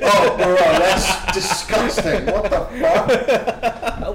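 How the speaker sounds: slow words through real laughter; laughing. Real, not acted. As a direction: laugh it out, disgusted. Reacting to a gross joke.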